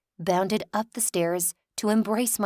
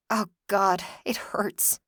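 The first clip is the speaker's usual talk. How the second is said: breaking into a whisper; pained whisper; slower than usual. Acted. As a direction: whisper it through the hurt. Heartbroken inner pain.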